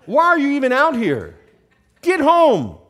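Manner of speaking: high, loud scolding; angry big brother. Acted. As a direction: bark it at a little brother, sending him home. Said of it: "he was angry".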